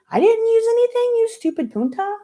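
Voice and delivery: very high, loud furious shriek; shrieking denial. A narrator's reading. Marisol screams back at Kelly in the hall, shrill and furious. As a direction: shriek it back at her, outraged.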